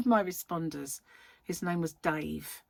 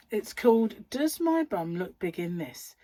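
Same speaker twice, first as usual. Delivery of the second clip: usual speaking voice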